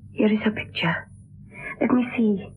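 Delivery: soft whisper to herself; hushed whisper over a portrait; whispered aside. Acted. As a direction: breathe it privately to yourself. Alone, looking at the picture.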